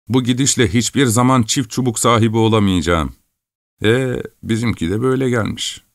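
lad grumbling about his lot; a resigned farm boy voice. A narrator's reading.

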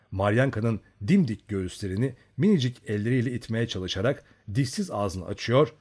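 usual speaking voice

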